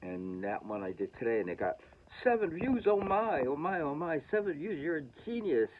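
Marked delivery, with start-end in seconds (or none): 2.2-5.7 high, mock-thrilled gushing over a tiny number; sarcastic glee. Vlogger mocking his own seven views, hammed-up delight. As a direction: gush sarcastically like it's a huge hit.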